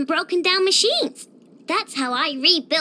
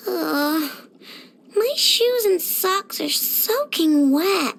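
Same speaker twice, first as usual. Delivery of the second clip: slow, drawn-out grumbling whine; miserable whine; slower than usual. Acted. Character in soaking wet shoes wanting home, miserable whine. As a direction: drag the words out like a sulky complaint. Soaked child wants to go home.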